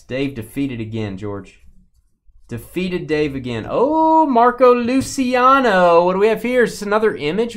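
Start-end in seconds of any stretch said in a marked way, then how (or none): 3.7-7.5 high, loud excited discovery; surprised. Real, not acted. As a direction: gasp at a surprise card. Surprised at a new card.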